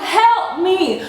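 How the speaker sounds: high repeated mock plea; cry for help. She re-enacts calling the cashier, mock-desperate. Repeats her appeal, laughing.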